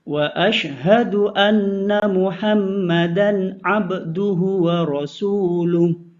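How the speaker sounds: usual speaking voice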